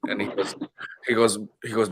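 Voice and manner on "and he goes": usual speaking voice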